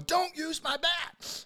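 high, slow mock yell over a bat. Host teasing guest about his bat, mock yelling. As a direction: drag it out like a furious kid. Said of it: "you're yelling".

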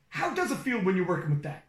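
normal talking voice

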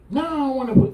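put-on high, loud whiny kid; high loud child refusal; refusing child. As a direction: whine back like a stubborn child. Child refusing prayer voiced.

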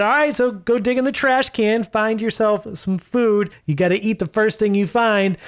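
high, bossy challenge voice; bossy dare voice. Retold or imitated. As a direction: lay out the dare like a game host. Retold rapper giving the dare.

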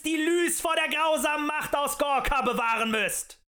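frenzied agitator's shout; shouting at full strength. A narrator's reading. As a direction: scream it at the crowd with all your might. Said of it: "schrie der Mann aus Leibeskräften".

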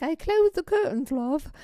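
put-on high-pitched British barmaid accent. Bartender reassuring the runaway, high-pitched British accent. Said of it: "she said it in the British accent".

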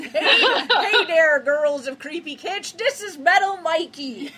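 hammed-up Minnesota accent, high and drawn-out; Midwestern accent. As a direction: put on a big cartoonish Minnesota accent. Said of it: "read that with the accent".

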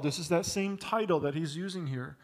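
normal talking voice

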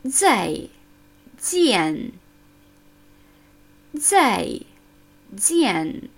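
"Zai" and "jian" said slowly and carefully; every sound of "Zai jian" articulated; over enunciating. Shown as a demonstration. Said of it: "Etwas komplizierter. Zwei Silben".

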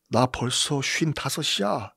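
soft, slow quoted remark; lightly acted, quietly resigned; understated weary emperor. Voices aged Tiberius on accession.

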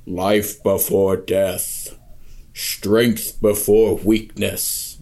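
slow, big booming narrator voice. Retold or imitated. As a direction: boom it out slowly like an epic oath. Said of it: "Michael Kramer always did it in that voice".

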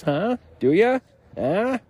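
high, pushy needling; taunting huh. Pretend. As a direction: goad them to take the job. Taunting boss.

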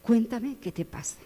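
put-on soft, soothing, like settling a child; the pastor soothes a restless child, soft and calming. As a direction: gently coax a kid to sit down. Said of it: "como con los niños cuando están".

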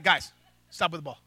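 low, soft, unbothered command; calm guard order. Retold or imitated. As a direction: say it flat and easy, deep.

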